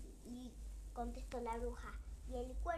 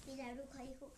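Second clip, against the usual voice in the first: ordinary delivery, nothing marked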